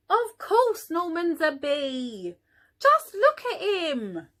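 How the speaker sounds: mild mock scoffing; scoffing bees. A narrator's reading. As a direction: brush her off, it's obvious. Bees dismiss Amelia.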